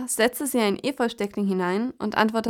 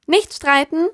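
hohe, helle Krähenstimme; schlichtende Krähe; 6 semitones higher, 4 dB louder, slower than usual. Acted. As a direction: ruf sie zur Ordnung wie eine kleine Krähe. Krähe schlichtet den Streit. (hohe Krähenstimme)

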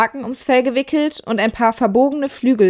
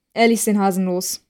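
usual speaking voice

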